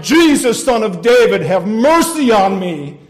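full-force cry, slowing at the end; desperate shouting. Acted. Sinner being hauled off to judgment, still shouting for mercy. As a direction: shout the plea as loud as possible. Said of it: "still shouting".

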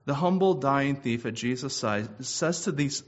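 normal talking voice

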